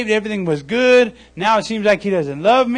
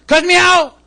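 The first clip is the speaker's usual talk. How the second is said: higher, louder, egging them on; impatient demand; 10 semitones higher, 10 dB louder than usual. Retold or imitated. Preacher's impatient self daring the man: loud and high. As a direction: hurry up and get it over with.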